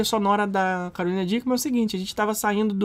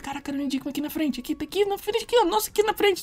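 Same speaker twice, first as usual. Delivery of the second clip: hushed, high excited undertone; soft, squeaky-high starstruck hiss; hushed aside; 6 semitones higher, 7 dB quieter, faster than usual. Retold or imitated. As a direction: urgently tell a companion under your breath. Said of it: "falei pra Rebeca".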